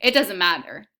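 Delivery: reading a novel aloud to students; steady read-aloud storytelling; story narration. A narrator's reading. Teacher reads novel aloud.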